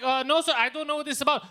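high, loud flustered caller; hammed-up panicky protest; mock freaking out. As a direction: imitate a rattled phone agent. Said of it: "starts freaking out".

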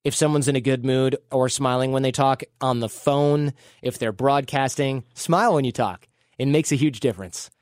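normal talking voice

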